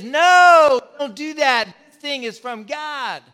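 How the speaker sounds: loud, drawn-out high no, then easing; angel's emphatic intervention; acted urgent command. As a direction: call out to stop someone, then settle. Preacher voices the angel stopping Joseph.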